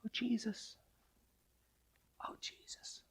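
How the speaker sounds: barely audible whispered plea; hushed cry. Shown as a demonstration. Preacher showing a too-timid cry to Jesus, whispered. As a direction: whisper it weakly, almost voiceless. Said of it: "I'm not gonna say".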